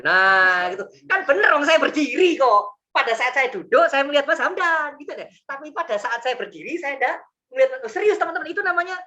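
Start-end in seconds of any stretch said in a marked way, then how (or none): 1.1-5.0 much higher and louder, slow triumphant stress; gleeful insistence. Real, not acted. Preacher to students on his standing trick, gleeful high insistence. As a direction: savour the clever loophole.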